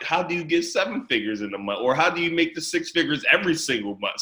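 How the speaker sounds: normal talking voice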